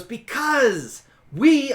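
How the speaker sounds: drawn-out, loud, high start; exasperated answer. Acted. Exasperated answer to another why.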